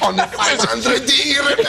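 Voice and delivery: high-pitched, laughing exclamation; amused delight. Real, not acted. Presenter relays mechanic's memory of a kiss, laughing in delight. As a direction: crow it through real laughter.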